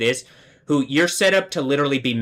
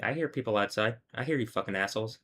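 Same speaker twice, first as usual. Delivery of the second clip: hushed mutter; 11 dB quieter, faster than usual. Real, not acted. Noticing people outside.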